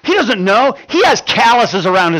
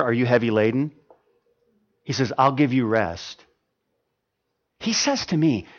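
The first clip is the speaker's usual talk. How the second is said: kindly spoken Lord; 8 semitones lower, 7 dB quieter than usual. Acted. The Lord speaks kindly to the weary, soft and tender. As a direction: lower and much softer, tender. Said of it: "someone else who speaks kindly to me".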